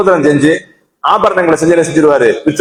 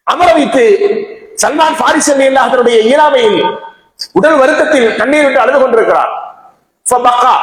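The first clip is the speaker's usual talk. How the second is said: raised-pitch, fervent sermon narration; impassioned preaching; 6 semitones higher than usual. A narrator's reading. Preacher telling of Salman weeping on his deathbed, impassioned preaching. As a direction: lift the voice high and carry each phrase.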